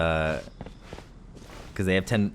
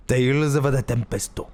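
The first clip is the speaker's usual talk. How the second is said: "Tempesto" with an added final o, syllable by syllable; mock Japanese accent, lightly done; Japanese-style pronunciation. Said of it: "That's how they would say it".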